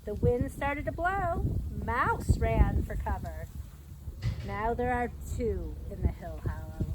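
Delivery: slow, lively picture-book storytelling; reading to children. A narrator's reading. Reading The Big Storm aloud to young listeners, lively storyteller. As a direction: read it like story time for little kids.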